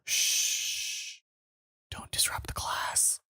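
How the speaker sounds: breathy hushed shushing, barely voiced; playful whisper. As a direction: hush the room like a sneaky student. Joking about being in class.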